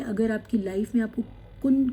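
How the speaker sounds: ordinary delivery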